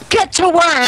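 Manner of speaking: aggressive boss. Acted. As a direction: order them around like a nagging spouse. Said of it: "like, aggressive".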